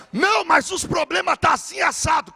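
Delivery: Pedro yelling in alarm; panicked shout. Acted. Pedro shouts to Jesus, panicked again over his problems.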